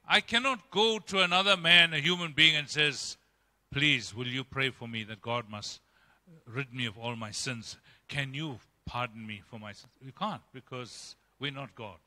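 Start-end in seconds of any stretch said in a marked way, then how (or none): 3.7-9.9 soft, low, pleading request; mock humble plea. Sinner asking a man for pardon, softly pleading. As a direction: beg quietly like a penitent.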